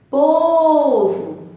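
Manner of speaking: "Povo", first syllable held high, ending short; stress on PO. Shown as a demonstration. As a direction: lean long on the PO in "Povo". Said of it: "Fala cada palavra".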